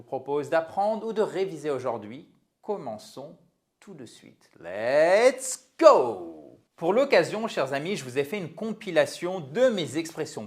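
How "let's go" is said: loud, drawn-out rallying call; kick-off cheer. Real, not acted. Teacher launching the English lesson, rallying cheer. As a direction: launch it like a starting shout.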